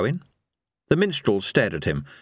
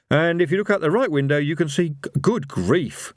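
high, quick character voice breaking off; brisk patter cut short by astonishment; Rincewind, translating then startled; 8 semitones higher than usual. Acted. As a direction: rattle it off, then stumble into dismay.

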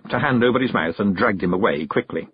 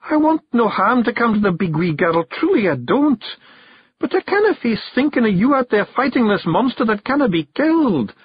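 tearful; 14 semitones higher, 3 dB louder than usual. A narrator's reading. Jeanie worries for Rob's life, tearful pleading. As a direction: plead with him, pitched high and upset. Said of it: "beginning to cry".

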